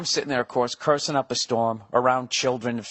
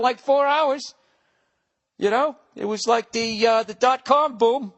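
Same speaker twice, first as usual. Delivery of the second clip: much higher, excited retelling; hammed-up patient; 11 semitones higher than usual. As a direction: play it up like a flustered patient. Patient retelling symptoms to doctor.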